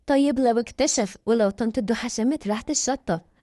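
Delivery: plain reading voice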